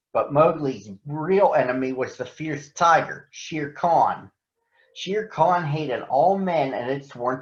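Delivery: steady storybook reading as the panther narrator; story narration. A narrator's reading. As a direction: tell it calmly to listening children. Reads story as Bagheera.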